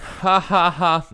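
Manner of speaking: high, loud, deliberate "ha ha ha"; mock laugh in separate syllables; hammed-up laughing at the joke; fake laugh. Mock laugh after beaten pun.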